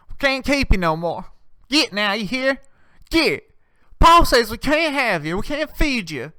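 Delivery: high-pitched rustic farm voice; country kid shooing a pet. Acted. Country owner sending off an unfed animal, rustic and high.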